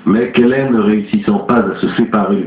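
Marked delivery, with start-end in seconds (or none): none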